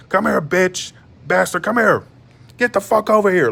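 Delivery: rising into a shrill mock yell; mama yelling. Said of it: "That's how my mama talks".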